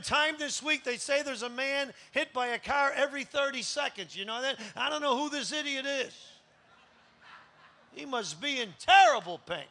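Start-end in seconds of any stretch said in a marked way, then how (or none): none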